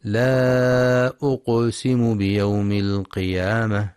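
the ق in أقسم bounced with a small echo, slow chant; echoing qalqala. Shown as a demonstration. Teacher demonstrating qaf qalqala, slow chanted verse. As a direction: chant slowly and let the silent qaf bounce. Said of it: "لقلقلة القاف الساكنة".

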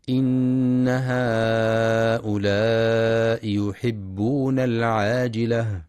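slow, drawn-out melodic tartil; slow Quranic recitation. Acted. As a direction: chant the verse with measured tajweed.

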